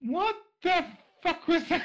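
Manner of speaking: incredulous high outburst; stunned what the fuck; shocked. Real, not acted. Reacting to bizarre question.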